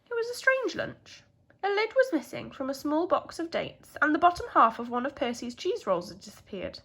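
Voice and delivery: ordinary delivery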